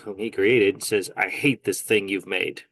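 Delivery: usual speaking voice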